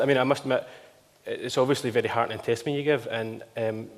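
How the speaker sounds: ordinary talk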